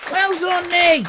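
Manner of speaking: high, pleased with yourself; mock proud pat on the back; mock self-praise.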